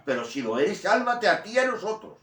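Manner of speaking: high, loud scornful cry; mocking thief shouting. Dying thief taunts Jesus, high and loud. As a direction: throw it at him as a taunt from the cross. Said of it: "Hay que ponerse en, en el puesto de él".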